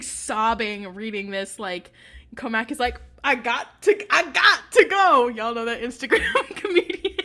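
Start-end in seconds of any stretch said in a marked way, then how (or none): 3.2-5.3 loud, high mock outburst; hammed-up urgent exit. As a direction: burst out as if desperate to leave. Imitating Comac's hasty exit.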